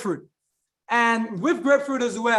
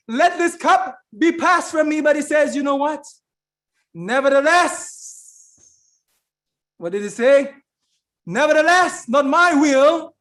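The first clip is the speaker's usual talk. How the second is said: high, raised pulpit delivery of a prayer; preaching; 4 semitones higher, 5 dB louder than usual. Retold or imitated. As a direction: proclaim the plea, pushing pitch and volume up.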